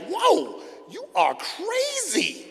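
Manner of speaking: high, drawn-out disbelief; acted shock. Son reacting to dad's warning, acted shock. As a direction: stretch it out slowly, high-pitched.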